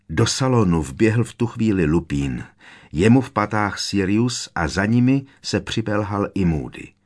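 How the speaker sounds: usual speaking voice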